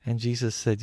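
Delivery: plain reading voice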